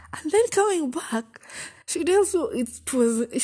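high, pleading, slowed-down lament; anguished high retelling. Retold or imitated. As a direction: wail it out like it still hurts. Retelling heartbreak, rising emotion.

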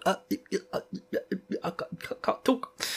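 breathless stammering, very soft, barely voiced; flustered teenage boy. Rand gaping at Selene in his shirt, flustered stammer. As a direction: stutter like a boy struck dumb. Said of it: "goes full teenage boy".

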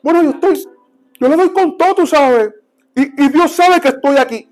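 high-pitched, halting self-justification; mock a defensive church leader; defensive excuse. Voices a self-satisfied leader's excuse.

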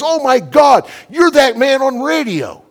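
high shouted retelling, drawn out at the end; mad, stunned outcry. Retold or imitated. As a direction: act out a kid's shocked recognition at full yell. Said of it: "He got so mad. He jumped up".

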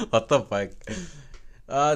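normal talking voice

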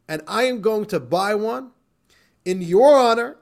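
loud, high, emphatic acted outrage; indignant tirade. Upset professor phoning the rabbi from hot dog stand, indignant. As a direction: declaim it like a furious formal accusation.

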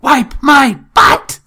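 put-on high, loud, slow child shout; imitating a three-year-old; toddler demand. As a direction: bellow it like an impatient toddler. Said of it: "three-year-old for".

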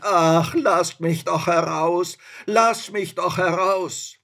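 high, loud, wailing plea; plaintive pleading. A narrator's reading. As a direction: beg pitifully to be let out. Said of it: "der Geist rief ganz kläglich".

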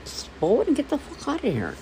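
high, heated comeback; hammed-up retort to a pushy voter; imagined angry retort. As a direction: snap back at the voter. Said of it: "That's what I wanted to say".

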